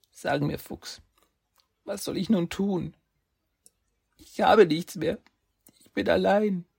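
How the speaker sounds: put-on high, forlorn boy's plea; lonely boy. Saki tells the fox he has nothing left, high and forlorn. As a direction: let his despair rise in pitch.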